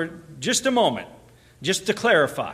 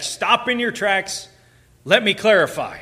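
emphatic interjection, pitched up; 4 semitones higher, 4 dB louder than usual. Acted. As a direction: halt them with each firm word. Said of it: "it's very emphatic".

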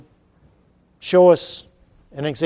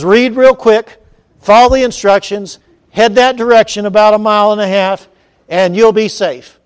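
raised, louder voice; calling out instructions; 4 semitones higher, 7 dB louder than usual. Acted. Boater tossing a book to a drowning man, calling out. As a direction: call it out firmly, phrase by phrase.

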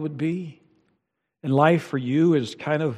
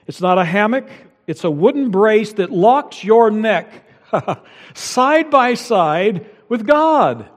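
higher and louder throughout; preaching at full force; 7 semitones higher, 7 dB louder than usual. Acted. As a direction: drive the point home to the congregation. Pastor preaching on the yoke.

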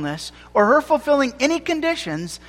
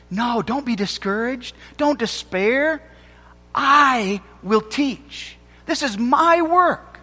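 voicing God; 4 semitones higher, slower than usual. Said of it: "But again, God says".

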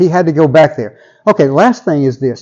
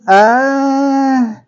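very high, incredulous objection; hammed-up, pitched-up pleading; Habakkuk protesting; 9 semitones higher, 4 dB louder than usual. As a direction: squeal a disbelieving protest up to God. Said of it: "Habakkuk responds".

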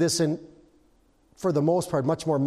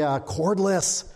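ordinary delivery

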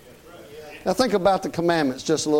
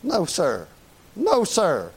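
normal talking voice

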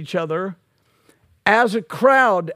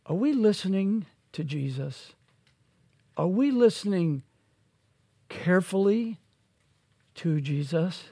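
softer questioning of the listeners; soft searching question; 7 dB quieter than usual. Acted. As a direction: ask it quietly and personally. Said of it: "soft searching question".

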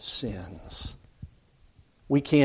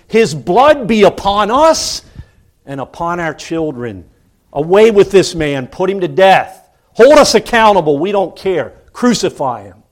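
loud, higher defiant demand; religious leaders' cry; 5 semitones higher, 8 dB louder than usual. Retold or imitated. Leaders demanding the man's death, loud and defiant. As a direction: hurl it back at Pilate, daring him. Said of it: "they would even say".

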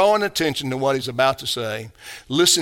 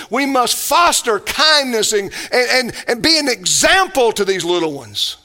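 fervent exhortation; 4 semitones higher than usual. Acted. Pastor urging his church, building fervent exhortation. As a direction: drive each "we must" home harder.

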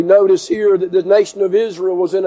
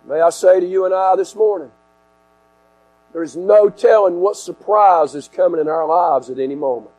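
ordinary talk, nothing marked

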